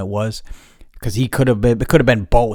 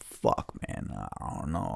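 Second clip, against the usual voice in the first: low, soft mumble; mumbled; 15 dB quieter than usual. Retold or imitated. Asked what he's looking for, low evasive mumble. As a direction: trail off as if dodging the question. Vague reluctant answer.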